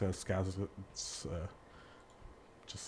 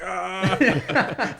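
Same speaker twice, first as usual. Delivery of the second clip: high, loud "ah" cry; loud comic imitation of a movie cry; movie impression; 10 semitones higher, 8 dB louder than usual. Imitating a Dumb and Dumber scene, high loud cry. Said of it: "where he's like".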